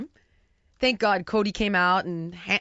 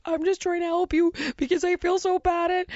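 higher, tearful mock plea from a crying wife; imitating her crying; 7 semitones higher than usual. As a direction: voice the wife's tears, lightly. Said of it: "And then she starts crying".

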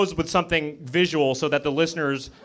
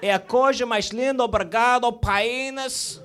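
high, lilting put-on Portuguese; high mock Portuguese, ending on "PIE-nuhs"; mock Portuguese accent; 6 semitones higher than usual. Shown as a demonstration. Comic showing off Portuguese to the crowd, high mock Portuguese accent. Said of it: "how I say penis in Portuguese".

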